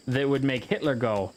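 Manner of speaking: usual speaking voice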